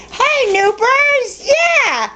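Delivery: very high, slow drawn-out hi; pet voice hello. Real, not acted. Owner greeting Snoopy, high slow pet-voice croon. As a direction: croon up high to the little dog.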